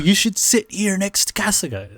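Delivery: high-pitched eager urging; retold excitement. Katoshi telling Nibu where to sit, mock excited. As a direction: imitate excited encouragement. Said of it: "They're all excited".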